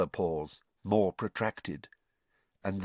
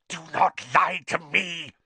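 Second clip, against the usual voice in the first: raised, loud, harsh hiss; 8 semitones higher, 5 dB louder than usual. A narrator's reading. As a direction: hiss the order furiously at the liar. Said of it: "hissed the second voice".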